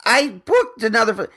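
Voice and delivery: indignant outburst. Real, not acted. Indignant rant about airline fees.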